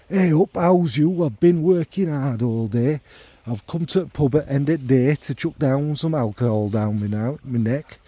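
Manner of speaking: hammed-up northern greeting, cheery after a hard day; northern pub bloke. Manual worker greets his mates at the pub in a mock northern accent. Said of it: "I don't know what that accent was".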